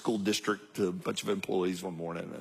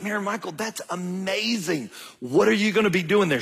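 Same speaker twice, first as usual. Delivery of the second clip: high, bright-eyed gush of delight; excited dad; 8 semitones higher than usual. Retold or imitated. Dad thrilled at daughter's new job, excited. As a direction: burst out thrilled for her.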